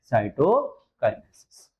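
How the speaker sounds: Cytokinesis spelled out slowly, falling at the end; slow dictation. Shown as a demonstration. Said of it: "क्या नाम देंगे बेटा". (धीरे बोलकर लिखवाना)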